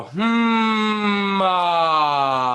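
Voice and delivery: high, loud hum opening into ah; loud resonant voice-warmup hum. Shown as a demonstration. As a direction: hum then release an open ah. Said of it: "you're gonna go".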